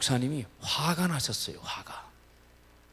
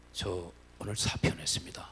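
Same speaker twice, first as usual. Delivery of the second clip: hushed apology; 6 semitones lower, 8 dB quieter than usual. Retold or imitated. Teacher telling the parent by phone, a whispered apology. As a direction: lower whispered confession.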